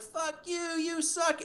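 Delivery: a hint of a rowdy crowd jeering; mock heckler.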